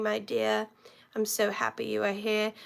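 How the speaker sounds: ordinary delivery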